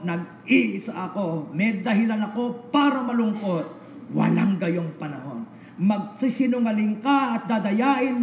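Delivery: high, loud fervent preaching; preaching at full force. Real, not acted. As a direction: drive it home to the congregation. Preaching climax, shouted nevers.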